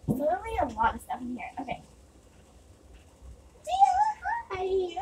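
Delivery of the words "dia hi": high sing-song name; calling the cat. Real, not acted.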